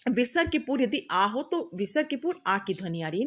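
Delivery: ordinary talk, nothing marked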